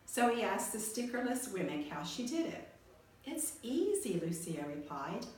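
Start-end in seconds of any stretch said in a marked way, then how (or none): 3.2-4.2 put-on higher, light girlish character voice; Lucia's voice. A narrator's reading. Lucia telling Punchinello her secret, high light voice. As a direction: voice Lucia, easy and breezy.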